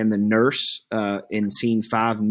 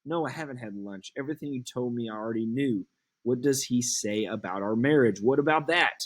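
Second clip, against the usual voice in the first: coaxing Juliet; 6 semitones higher than usual. Acted.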